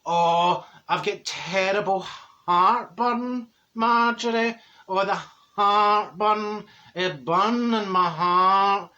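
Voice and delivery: very slow, drawn-out mock complaint; moaning Dorothy. Friend complains to Marjorie of heartburn, slow moaning imitation. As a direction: groan the words out as you imitate her. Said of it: "She says".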